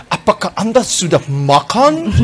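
slow, overly formal foreigner's Indonesian; hammed-up textbook phrasing, drawn out; mock stiff textbook speaker. (logat baku kaku yang dibuat-buat, pelan)

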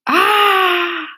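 high mock scream of fright; storybook fisherman's scared yell; startled scream. A narrator's reading. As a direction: yelp as if the shark just smiled at you. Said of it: "screamed the fisherman".

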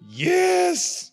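high, loud delighted yes; excited shout. Real, not acted. Leader confirms the British accent to a reader, high excited shout. Answers question about the accent.

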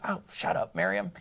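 mock Hebrew comeback, whispered; hushed mock retort. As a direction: breathe it out like a teasing brother. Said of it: "That was my Hebrew".

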